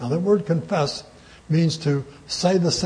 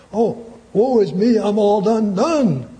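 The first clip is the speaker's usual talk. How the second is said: mock-dismayed outcry, higher and louder; dismayed cry; 5 semitones higher, 4 dB louder than usual. Preacher voices Isaiah undone before a holy God, dismayed. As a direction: cry out in woe, pitch lifted.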